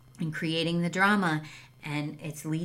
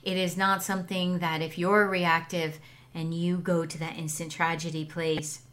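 ordinary talk, nothing marked

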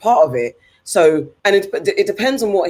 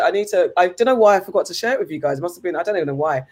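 ordinary delivery

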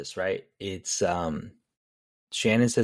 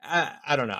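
usual speaking voice

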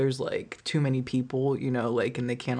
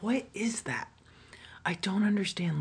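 breathy disbelieving whisper, slightly higher; awed whisper; 5 semitones higher, 3 dB quieter than usual. Real, not acted. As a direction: whisper it like you can't believe that voice. Awed by his deep voice.

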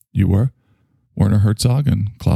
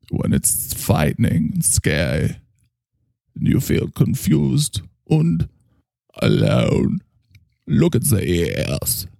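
German hypnotist; 3 semitones higher than usual. Acted.

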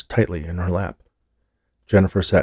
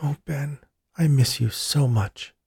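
tearful joy; 6 semitones higher, slower than usual. A narrator's reading. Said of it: "Tears welled up in her eyes".

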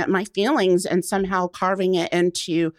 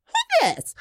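much higher, thrilled who's this; delighted squeal. Retold or imitated. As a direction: squeal it at your new self in the mirror. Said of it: "I was like".

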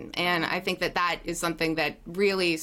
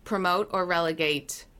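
usual speaking voice

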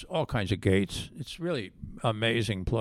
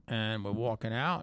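ordinary talk

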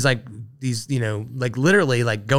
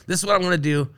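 mock self-pitch; 7 semitones higher than usual. Mocking his own pitch.

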